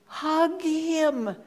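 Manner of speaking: a lightly voiced inner urge, hug him; urging inner voice.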